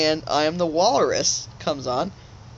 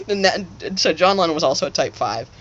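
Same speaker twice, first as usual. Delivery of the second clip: ordinary delivery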